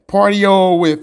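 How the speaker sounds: usual speaking voice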